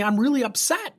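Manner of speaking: high-pitched, indignant complaint; upset mother. Retold or imitated. Angry mother confronting the coach, upset. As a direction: imitate an upset parent, pitch pushed up. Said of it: "She's angry".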